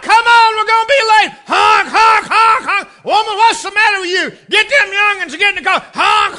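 high, loud, impatient yelling; impatient husband shouting. Retold or imitated. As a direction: bellow like a hurried husband honking. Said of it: "how the independent Baptists do it".

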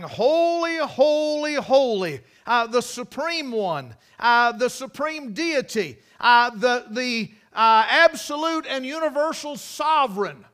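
preacher voicing the angels' loud cry of praise. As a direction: cry it out like angels before the throne. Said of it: "They're crying".

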